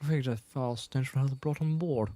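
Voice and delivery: understated English-accented impression; Princess Leia. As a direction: quote it quietly in a mock English accent. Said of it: "this is when she had an English accent".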